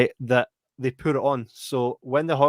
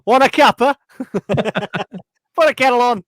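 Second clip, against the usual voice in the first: put-on high, jokey English housewife accent; English accent, teasing his mate; mock English accent; 11 semitones higher, 5 dB louder than usual. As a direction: ham up an English accent offering tea.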